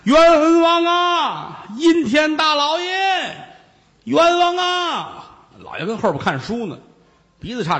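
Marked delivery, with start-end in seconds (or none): 0.0-5.2 long drawn-out high wailing shout; crying out injustice. Acted. Monk shouting at the yamen gate, drawn-out wail. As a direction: wail it slowly like a plaintiff at the gate. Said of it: "站在门口这儿一喊".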